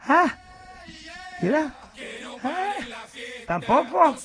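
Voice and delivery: loud exasperated yells at the radio; frustrated shout. Real, not acted. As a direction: shout in exasperation as every station fails. Yelling at radio stations.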